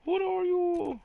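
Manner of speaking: high, loud startled cry. Real, not acted. Gamer shocked by a strange creature, startled cry. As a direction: yelp it out in sudden surprise.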